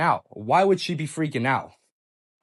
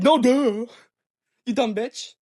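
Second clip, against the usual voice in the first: scornful retort; 7 semitones higher, 4 dB louder, slower than usual. Retold or imitated. Boyfriend answers an obvious question, scornful. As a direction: throw it back with exasperated scorn.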